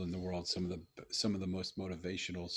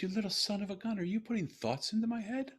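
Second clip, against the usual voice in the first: delighted teasing; 12 semitones higher than usual. Retold or imitated. Father delighted by daughter's trick.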